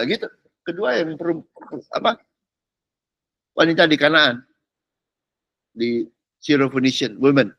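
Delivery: ordinary talk, nothing marked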